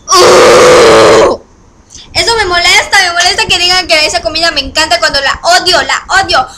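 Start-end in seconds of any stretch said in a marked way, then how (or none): 0.1-1.4 loud low frustrated grunt; frustrated groan. Real, not acted. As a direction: let out a deep angry groan. Grito de frustración.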